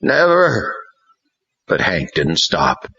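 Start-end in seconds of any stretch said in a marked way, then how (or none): 0.0-0.8 higher, hoarse wild refusal; hoarse wild boy. A narrator's reading. Possessed Ron refusing Hank, hoarse and wild. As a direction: refuse wildly. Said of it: "his voice hoarse".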